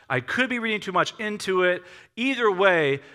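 ordinary talk

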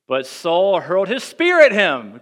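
dramatic read. A narrator's reading. Dramatic reading of the spear throw.

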